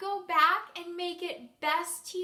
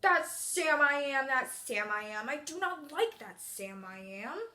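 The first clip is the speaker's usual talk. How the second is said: grumpy character voice; 3 semitones lower than usual. Shown as a demonstration. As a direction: grumble it like a storybook grouch. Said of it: "I'm gonna use a grumpy voice".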